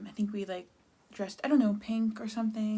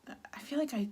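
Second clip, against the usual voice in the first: breathy whisper; 7 semitones higher than usual. Real, not acted. Breaks off mid-thought.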